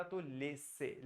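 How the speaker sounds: slow, soft laisser said लेसे; French laisser. Shown as a demonstration. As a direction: laisser with a double स and a final ए. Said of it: "ईआर को ए पढ़ा". (धीमे से लेसे)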